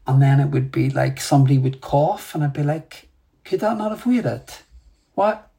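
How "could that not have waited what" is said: high-pitched, exasperated mock complaint; irritated. Meditator griping inwardly at a dramatic cougher, irritated. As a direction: retell your own indignant inner commentary, pitched up. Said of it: "went to this really irritated state".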